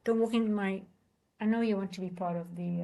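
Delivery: ordinary talk, nothing marked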